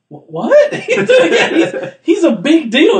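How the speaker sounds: high loud laughing disbelief; laughing mock shock; laughing quote. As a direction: squeal it out laughing. Guys amazed Groot is king.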